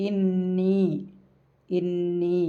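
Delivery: ഇന്നി with the double n held and humming through the nose; ഇന്നി recited with a long nasal nn; nasalised. Shown as a demonstration. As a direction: let ഇന്നി hum, holding the nn. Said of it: "മണിക്കുക".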